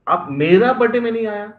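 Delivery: "मेरा" thrown up high and loud; quick, indignant punch on "मेरा"; stress on मेरा. Shown as a demonstration. Best friend's complaint acted for the class, heavy stress on "मेरा". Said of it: "emphasis on the different words".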